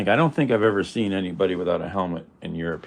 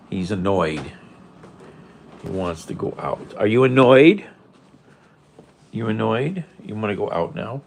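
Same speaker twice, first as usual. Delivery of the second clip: ordinary delivery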